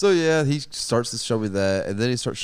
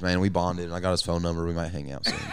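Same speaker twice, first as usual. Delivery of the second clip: normal talking voice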